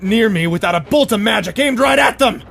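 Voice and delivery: frantic, paranoid threats, building to a shout. Acted. Prince warning his guard back, paranoid and shouting. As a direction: hold them off with wild suspicion.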